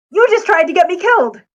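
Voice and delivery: high, heated outburst; angry accusation. Acted. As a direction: accuse him hotly of nearly killing you. Furious at near death.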